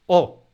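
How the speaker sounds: "O" sola, más fuerte y con golpe de voz; o aislada acentuada. Shown as a demonstration. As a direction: suelta la conjunción "O" con acento propio. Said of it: "Si la pronuncio separada, entonces sí". (la conjunción "O" dicha aislada y acentuada)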